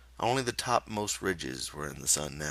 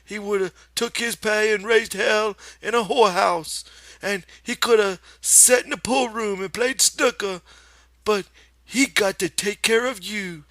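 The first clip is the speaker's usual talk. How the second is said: high, loud nagging aunt voice; scolding old aunt; 7 dB louder than usual. Acted. Aunt Clara scolds Lenny, high nagging voice. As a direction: scold him like a fed-up aunt.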